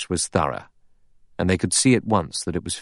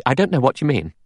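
higher, sharp denial; sharp rebuff; 7 semitones higher, faster than usual. A narrator's reading. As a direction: answer sharply, pitched up. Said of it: "She said sharply".

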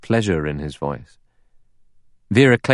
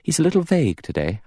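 hesitant; 10 semitones higher, faster than usual. A narrator's reading. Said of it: "She hesitated".

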